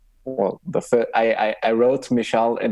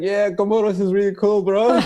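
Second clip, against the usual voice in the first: laughing; 10 semitones higher than usual. Retold or imitated. Faking familiarity with Comoros.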